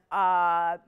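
mock-unimpressed viewer with a laugh; lightly voiced sceptical shopper; imitating unimpressed viewer. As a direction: play a shrugging viewer who doesn't get the deal. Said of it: "you're looking at that going".

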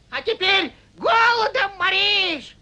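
ordinary delivery

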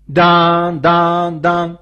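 long ringing bell strokes on one note; imitated church bell. Preacher imitates the Sunday bell, tolling "dan". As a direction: toll like a bell, each stroke fading. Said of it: "χτυπάει ο Χριστός την καμπάνα".

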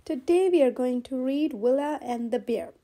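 ordinary delivery

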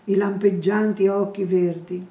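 ordinary delivery

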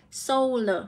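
Solar with a clear "oh" in the first syllable; "oh" vowel in Solar. Shown as a demonstration. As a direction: repeat "Solar" as a model, SOH-ler, falling. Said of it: "là âm Âu nhá".

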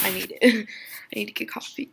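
normal talking voice

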